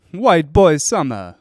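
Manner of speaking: slow, loud, high drawn-out delivery. Retold or imitated. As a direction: stretch it out like a party bro. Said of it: "That sounded like [name]".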